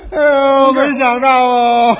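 loud mock sobbing, slow; a mourner's overdone, drawn-out wail; hammed-up wailing. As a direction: bawl theatrically like a mourner. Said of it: "你哭，我劝你。".

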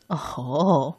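low, soft musing grandma; old granny voice. Acted. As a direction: hum it like a thoughtful old woman. Grandma answers mouse.

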